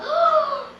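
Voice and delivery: sharp high gasp of shock. Acted. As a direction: suck in air in horror. Girl discovers brother eaten.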